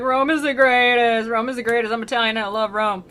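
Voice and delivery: mock super-fan chanting praise; imitating a besotted Rome fanboy; gushing fanboy. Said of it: "He was, like, super fanboy to Rome".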